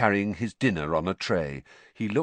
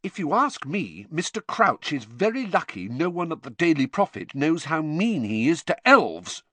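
a mild angry edge, a bit higher; indignant on behalf of the elves; 8 semitones higher, 4 dB louder than usual. A narrator's reading. Girl angrily criticising an official over house elves. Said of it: "said Hermione angrily".

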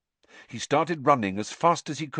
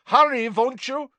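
high, raised call; loud call at a much higher pitch; calling after someone; 6 dB louder than usual. A narrator's reading. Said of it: "Crumb called after him".

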